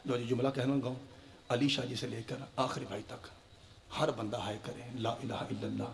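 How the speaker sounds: soft, hushed lament voice; mournful masaib recitation. Acted. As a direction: draw them gently into grief. Masaib recitation after fazail.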